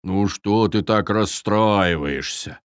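slow, gentle Viking voice; soothing reassurance. A narrator's reading. Viking comforts crying fawn, soothing slowly. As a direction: comfort him slowly and tenderly. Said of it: "потрепал плачущего оленёнка за ушком".

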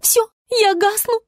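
high, despairing farewell; dramatic dying star. A narrator's reading. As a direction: wail goodbye in a high star voice. (отчаянное прощание высоким голосом)

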